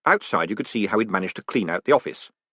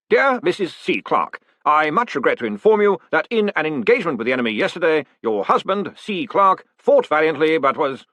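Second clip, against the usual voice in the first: higher, formal officer's voice; a stiff military regret, slowing at the end; army officer's condolence letter; 7 semitones higher than usual. A narrator's reading. An officer informing a wife of her husband's death, formal regretful voice.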